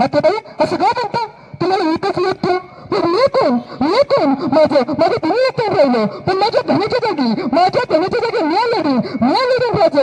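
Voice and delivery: high, rising vow to fight; fierce young widow. Acted. Widow to the king, vowing to fight. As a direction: she steps up in her husband's place.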